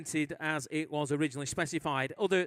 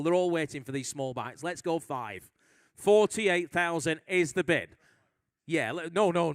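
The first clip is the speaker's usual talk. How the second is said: normal talking voice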